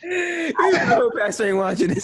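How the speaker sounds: high, loud laughing yell; laughing shout. Real, not acted. Guest shouting a joke about his pastor, high and loud.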